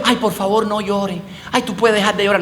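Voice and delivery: half-hearted sweet plea; mock gentle pleading. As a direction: coax her like a weak request. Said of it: "No le está diciendo".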